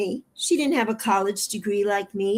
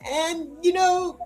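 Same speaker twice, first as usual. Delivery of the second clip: real crying, much higher, voice breaking; 7 semitones higher than usual. Real, not acted. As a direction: sob out the hurt about her mother. Hurt by attacks on her mother.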